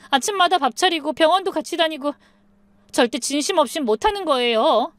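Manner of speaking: high-pitched, whining entreaty; desperate pleading. A narrator's reading. Daughter-in-law begs mother-in-law to trust her, pleading. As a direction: beg to be believed, voice pitched high throughout.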